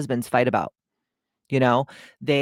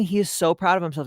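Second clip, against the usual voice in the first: ordinary talk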